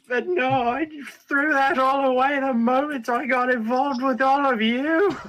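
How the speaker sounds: high, wailing self-pity; tearful. Acted. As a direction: whine it close to tears. Neon still breaking down.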